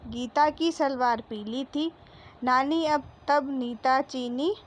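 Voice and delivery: slow, careful classroom reading; reading to children. Acted. Teacher reading the story to the class, slow and careful. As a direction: read the passage clearly for little pupils. Teacher reads the lesson passage.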